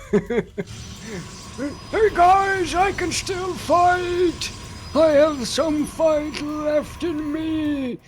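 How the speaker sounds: heroic little Marine. Pretend. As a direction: play the last wounded Marine refusing to quit. Voicing a one-health-point Marine.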